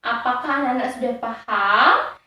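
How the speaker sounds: ordinary talk, nothing marked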